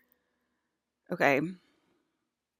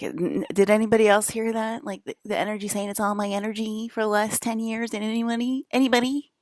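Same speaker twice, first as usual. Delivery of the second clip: mock worried viewer; 5 semitones higher than usual. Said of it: "a million videos, like".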